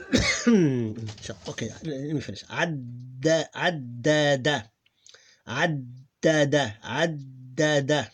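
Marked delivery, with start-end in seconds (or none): 3.5-8.1 addada drawn out slowly, each syllable clear; slow demo. Shown as a demonstration. Teacher slowly models addada for learners. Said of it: "Here we lose adda".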